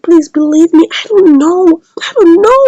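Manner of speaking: loud, rising acted plea; desperate pleading. Acts out accused man pleading.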